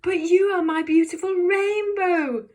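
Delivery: high, loud, tearful plea; desperate pleading cry. Shown as a demonstration. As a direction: beg her with a wail in the voice. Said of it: "Say that with me".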